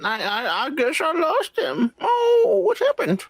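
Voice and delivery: hammed-up high bewilderment; mock clueless characters. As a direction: play them dopey and baffled, way up high. Mock dialogue of clueless shooters.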